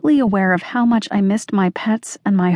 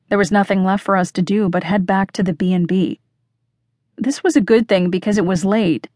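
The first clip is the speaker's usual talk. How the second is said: usual speaking voice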